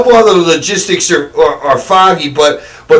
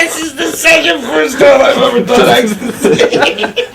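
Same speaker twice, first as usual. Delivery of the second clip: laughing; 7 semitones higher than usual. Real, not acted. Jokes through laughter.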